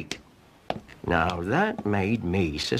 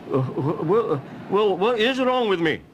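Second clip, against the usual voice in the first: higher, stammering mock worry; worried stammer; 9 semitones higher than usual. Cromarty worried about his diagnosis. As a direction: stumble over the words nervously.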